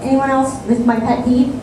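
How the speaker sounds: ordinary talk, nothing marked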